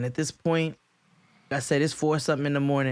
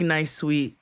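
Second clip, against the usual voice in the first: usual speaking voice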